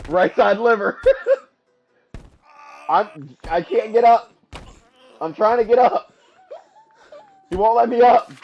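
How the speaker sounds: loud, high, through laughter; laughing shout from a knocked-down gamer; laughing helplessly. Real, not acted. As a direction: protest while cracking up. Laughing while knocked down in game.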